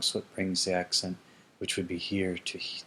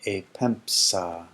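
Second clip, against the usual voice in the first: slow form read; much slower than usual. Shown as a demonstration. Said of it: "reads aorist form".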